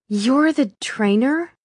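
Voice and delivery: dawning surprise. A narrator's reading. Realization dawning.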